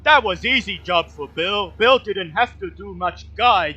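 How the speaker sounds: big dim guide, happy and loud; simple-minded Bill. Acted. GM voicing Bill, recalling an easy job.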